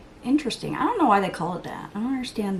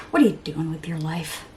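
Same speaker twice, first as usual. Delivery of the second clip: ordinary delivery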